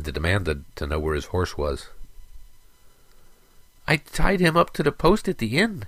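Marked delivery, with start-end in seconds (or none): 3.9-5.8 put-on high-pitched little boy voice. A narrator's reading. Boy answers Sarek about the tied-up horse, in a high child's voice. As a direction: voice a small child, much higher. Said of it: "said the little boy".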